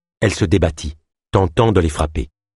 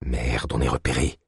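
low, much softer breathed curse; 8 semitones lower, 8 dB quieter than usual. A narrator's reading. Ganesh warns Ava they are spotted, low breathed. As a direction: say it under your breath. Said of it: "souffla Ganesh".

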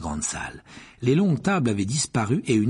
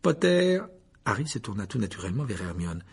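a hint of a cold professor; 6 semitones higher than usual. A narrator's reading. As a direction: hand out orders with icy calm. Snape assigning pairs.

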